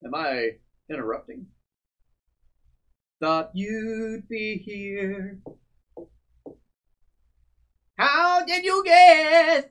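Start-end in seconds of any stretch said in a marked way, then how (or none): 3.2-9.6 slow, dramatic character reading; game character voice. Acted. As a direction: read the character's lines with gravity. Voicing game character lines.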